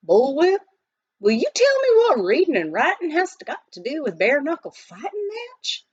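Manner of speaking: lightly voiced cranky miner; exasperated miner. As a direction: demand an answer, baffled. Billy protests.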